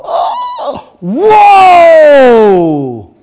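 huge high laughing whoa, very loud; amazed shout. Retold or imitated. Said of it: "the people go".

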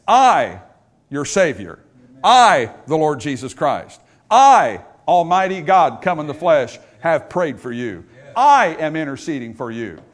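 each "I" punched loud, with pauses; stress on I. Shown as a demonstration. Preacher voicing Christ as the one praying, stress on each "I". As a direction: hammer every "I", then ease off on the words after. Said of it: "It emphasizes the person praying".

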